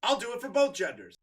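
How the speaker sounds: ordinary delivery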